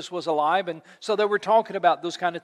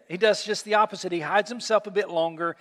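ordinary delivery

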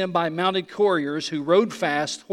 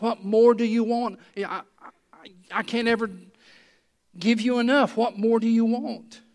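exasperated; 5 semitones higher than usual. Acted. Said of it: "It's almost like".

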